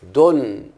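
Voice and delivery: دن sounded out on its own, punched; syllable demo. Shown as a demonstration. Teacher sounding out a syllable for students. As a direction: isolate the syllable دن clearly. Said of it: "الضمة دي تكتب حركة مايلة".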